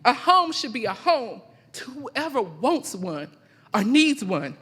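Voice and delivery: loud, emphatic live poetry recital with measured pauses; spoken-word poem. Acted. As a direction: declaim it line by line, letting each phrase land.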